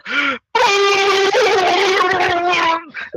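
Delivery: long high wavering mock scream; imitated electrocution scream. Guy hit by shockers and tasers, mock scream. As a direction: wail on and on as if being tasered.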